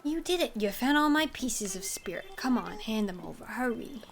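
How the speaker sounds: lively New York game character; New York accent. Acted. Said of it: "I'm giving a New York accent".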